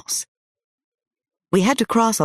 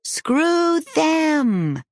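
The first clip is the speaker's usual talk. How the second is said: put-on high, cheering "Screw them!" yelled by the kids; cheering shout; 13 semitones higher than usual. Kids cheering back at their friend, high whooping shout. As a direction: whoop "Screw them!" like a triumphant crowd of children. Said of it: "They loudly cheered and whooped".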